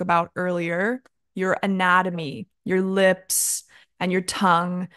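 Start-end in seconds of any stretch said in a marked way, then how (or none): none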